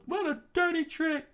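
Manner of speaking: very high falsetto protest; indignant rebel. Acted. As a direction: cry foul up in falsetto. Rebel crying betrayal.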